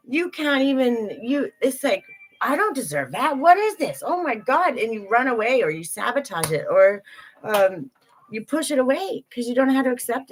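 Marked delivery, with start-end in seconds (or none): 2.4-4.8 acting out a shocked, overwhelmed reaction, pitched higher; mock stunned at being treated kindly; flustered disbelief. Imagined reaction to someone's kindness.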